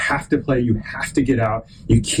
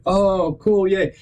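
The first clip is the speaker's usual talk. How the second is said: higher mock-impressed mom voice; imitated cooing mom; 7 semitones higher, much slower than usual. Mom praising whatever her kid does, lightly voiced cooing. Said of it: "normally my mom wouldn't be like".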